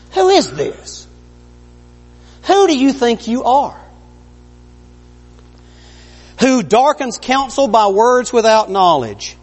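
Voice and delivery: high, booming God rebuke; stern rebuke. Shown as a demonstration. Preacher voicing God's stern challenge to Job, booming. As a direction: thunder it down at Job. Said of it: "I don't know what about, about inflection".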